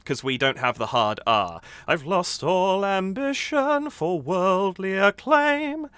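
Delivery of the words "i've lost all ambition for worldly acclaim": slow, high, overdone American R on worldly; mock crooner's American accent; exaggerated American inflection. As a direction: ham up the American lilt, drawn out and higher. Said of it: "I'm exaggerating a little bit".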